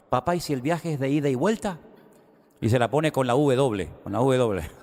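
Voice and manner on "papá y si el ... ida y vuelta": put-on high, eager little-boy voice; child voice. A boy asking his father, in a high childlike voice. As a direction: play the clever kid springing the question.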